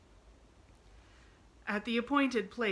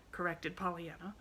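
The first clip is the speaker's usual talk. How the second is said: ordinary delivery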